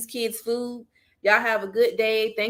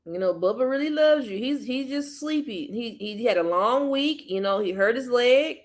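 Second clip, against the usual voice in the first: tender comfort. Real, not acted. Comforting crying child.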